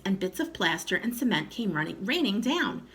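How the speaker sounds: usual speaking voice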